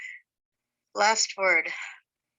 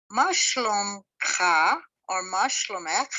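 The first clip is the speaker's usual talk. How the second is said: slow Hebrew question; much slower than usual. Shown as a demonstration. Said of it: "your conversation".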